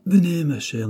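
crié, plus haut et plus fort; héler la fille à travers la salle; appel crié. A narrator's reading. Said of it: "lui cria le sculpteur". (le sculpteur appelle la bouquetière, crié)

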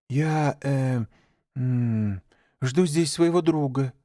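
put-on a child fumbling a lie; clumsy lie. A narrator's reading. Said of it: "неумело солгал Маугли".

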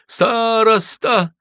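high, drawn-out sobbed word; sobbing cry. A narrator's reading. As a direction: blurt it through a sob. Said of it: "всхлипнула девушка". (всхлипывая)

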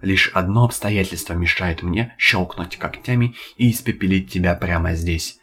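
usual speaking voice

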